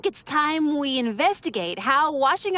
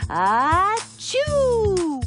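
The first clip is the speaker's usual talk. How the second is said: fake sneeze; 4 semitones higher than usual. Shown as a demonstration. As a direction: demo sneeze into arm. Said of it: "just like this".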